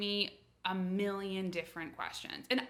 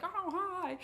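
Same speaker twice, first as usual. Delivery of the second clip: put-on high-pitched, gushing greeting from an American girl; chirpy American girl; 10 semitones higher than usual. American girl out dancing alone in France, high chirpy greeting. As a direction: play the American girl's high hello. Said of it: "I would be like this American girl".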